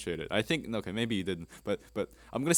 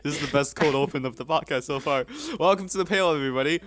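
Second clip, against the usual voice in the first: laughing excitement; 7 semitones higher, 6 dB louder than usual. Real, not acted. Still laughing, loud intro.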